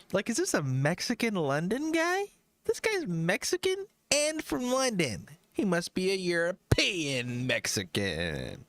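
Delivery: high-pitched mock bewilderment; mock puzzled listener. Imagining listeners guessing where he is from, mock puzzled. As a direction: put on a high, wondering voice.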